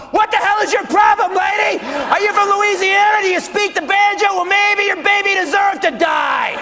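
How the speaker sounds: high, loud unhinged yelling; angry outburst. Acted. Comedian suddenly snaps at a woman in the crowd, angry yelling.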